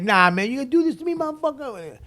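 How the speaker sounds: high-pitched, rushed mock taunt; trash-talking. Rival MC trashing the comic as he walks up, high mock taunting. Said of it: "this kid was trashing me".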